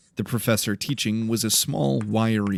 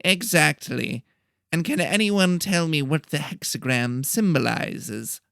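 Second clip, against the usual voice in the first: a fussy little teacher calling the class to order; nasal professor; 6 semitones higher than usual. A narrator's reading. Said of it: "He talked in a nasally voice".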